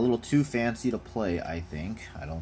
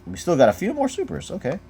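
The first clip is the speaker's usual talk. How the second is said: quick, higher and louder burst of excitement; pleased to find more rares, speeding up; excited; 11 semitones higher, 7 dB louder, faster than usual. Real, not acted. Opening card packs, spots more rares, excited.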